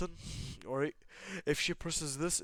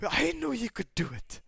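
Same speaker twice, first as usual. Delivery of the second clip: high, loud congratulation; proud cheer; 12 semitones higher, 7 dB louder than usual. Acted. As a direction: cheer a friend on, voice leaping up. Darby cheering on his friend.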